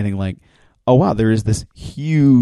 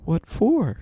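much higher, lightly voiced bewildered Mickey; high puzzled Mickey; 10 semitones higher than usual. Mickey asks Rose why she thanks him, high and puzzled. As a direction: ask it high, baffled, as Mickey.

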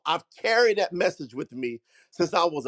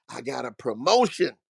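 ordinary talk, nothing marked

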